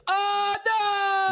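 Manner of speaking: hammed-up wail of someone falling away; mock falling scream. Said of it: "the whole way down they're screaming".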